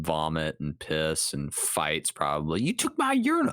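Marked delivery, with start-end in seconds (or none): 2.6-3.5 put-on high, loud drunk whine; hammed-up bathroom brawler; angry drunk fan. Acted bathroom fight.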